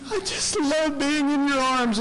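lightly voiced doting lamb; mock lamb adoration. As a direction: play the adoring little lamb. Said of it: "it's looking up at Jesus like".

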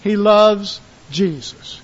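loud, slow emphasis; stress on loves. Real, not acted. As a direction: hit loves hard. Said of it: "He hates Adam".

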